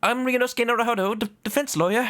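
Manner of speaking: hurried stammering introduction; lightly voiced nervous lawyer.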